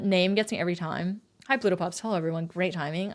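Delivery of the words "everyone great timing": ordinary talk, nothing marked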